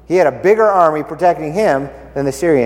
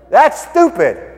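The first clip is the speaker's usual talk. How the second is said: slow, drawn-out scoff; scornful, higher and slow; 9 semitones higher, 4 dB louder, slower than usual. Real, not acted. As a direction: say it like it's obviously dumb. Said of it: "teacher mocking the plan".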